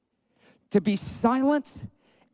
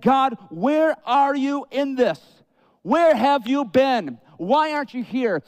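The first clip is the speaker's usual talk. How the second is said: slow anguished plea; 5 semitones higher, 3 dB louder, slower than usual. Retold or imitated. Anguished cry to God.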